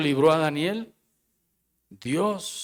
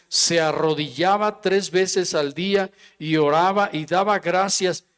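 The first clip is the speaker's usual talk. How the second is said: raised, ringing Bible reading; scripture reading; 3 semitones higher, 5 dB louder than usual. Acted. Pastor reading Daniel to the church, raised and ringing. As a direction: proclaim the verses to the congregation.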